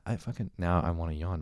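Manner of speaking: ordinary delivery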